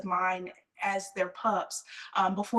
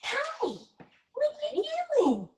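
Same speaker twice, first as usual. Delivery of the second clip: puppy talk. Real, not acted. Greeting puppy Nita, high baby talk. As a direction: coo at the pup playfully.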